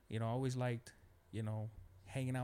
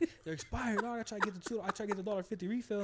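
higher, hammed-up complaint about the cups; mock indignant buddy; 8 semitones higher, 4 dB louder than usual. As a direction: play the friend as put out, pitched up. Imitating friend complaining about cups.

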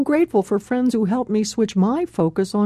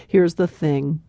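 ordinary talk

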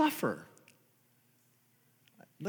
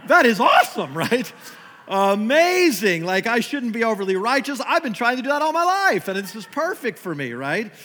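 loud, high, hammed-up delight; sarcastic over-the-top excitement; mock enthusiasm; 5 semitones higher, 6 dB louder than usual. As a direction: overplay your joy as a joke. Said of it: "sarcastic mock enthusiasm".

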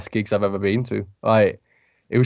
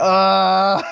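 high, loud shriek of delight; excited fan squeal; 10 semitones higher, 5 dB louder than usual. Retold or imitated. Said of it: "I was like".

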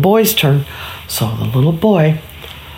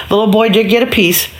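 ordinary delivery, nothing marked

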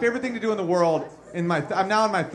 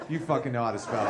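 laughing retort; 9 semitones lower, 7 dB quieter, faster than usual. Retold or imitated. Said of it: "I'm like".

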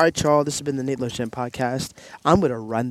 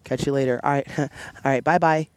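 louder, laughing mock goodbye; casual phone sign-off; 2 semitones higher, 4 dB louder than usual. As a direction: act out a too-casual call ending, chuckling. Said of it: "got off the phone with Miss Karen".

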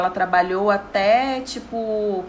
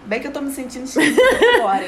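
laughing joke; 5 semitones higher, 5 dB louder, faster than usual. Pretend. Jokingly claims catching the cough.

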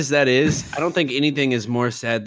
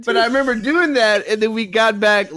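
laughing hard through words; breaking up with laughter; 7 semitones higher than usual. Real, not acted.

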